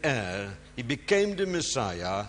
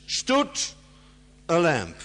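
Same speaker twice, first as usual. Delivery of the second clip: "Stood" jumps high and loud, pause after; stress on STOOD; 4 semitones higher, 5 dB louder than usual. Shown as a demonstration. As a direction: hit "Stood", then drop on "a lamb". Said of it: "emphasis is always on the first word".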